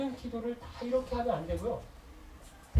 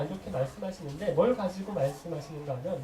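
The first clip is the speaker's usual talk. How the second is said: ordinary delivery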